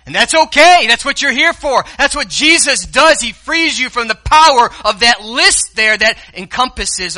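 loud, high, rising preacher's cry; full-throated sermon peak; preaching at full force. Acted. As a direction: lift the room with a shout of freedom.

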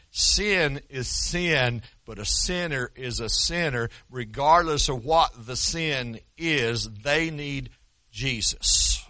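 each word set apart, very slow; slow emphatic point. Acted. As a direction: lay it down word by word. Preacher drives home key point.

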